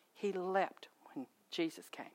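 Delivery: hushed, slow whisper. Real, not acted. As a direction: drop to an awed whisper. Sermon point about unborn child.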